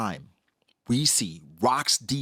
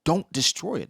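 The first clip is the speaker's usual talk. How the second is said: usual speaking voice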